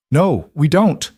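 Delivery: calling back. A narrator's reading. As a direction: call it back, drawing out each word. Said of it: "Lyra called back".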